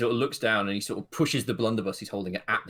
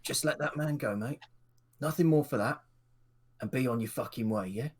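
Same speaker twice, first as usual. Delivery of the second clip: firm no-nonsense order from a station guard; gruff guard; 3 semitones higher than usual. Acted. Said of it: "he goes".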